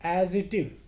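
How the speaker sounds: एडिजेक्टिव said as ed-ji-tiv; correct pronunciation. Shown as a demonstration. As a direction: repeat एडिजेक्टिव as the right model. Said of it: "एडिजेक्टिव नहीं".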